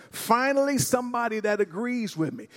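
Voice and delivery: delighted chicken. Acted. Chicken to pig who finally agrees, delighted. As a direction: play up the delight at being agreed with.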